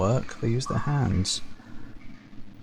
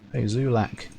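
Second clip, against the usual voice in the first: normal talking voice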